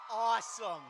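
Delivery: high, loud cheering shout; crowd hype shout. Real, not acted. Host thanking cheering crowd, high loud shout. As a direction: pump up the audience.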